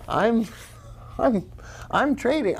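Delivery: high, silly hobbit voice; goofy high-pitched Frodo imitation; mock Frodo. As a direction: squeak it like a nervous hobbit. Mock Frodo voice after he's like.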